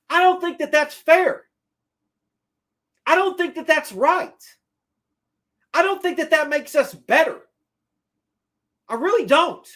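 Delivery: pleading the case hard, voice up; impassioned protest. Retold or imitated. Passionate objection to firing Gettleman.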